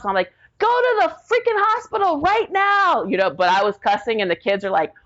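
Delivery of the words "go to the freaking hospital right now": high, urgent shouted command; frantic order. Retold or imitated. Wife orders the brother-in-law to the hospital, high frantic yell. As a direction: yell at him to drive to the hospital.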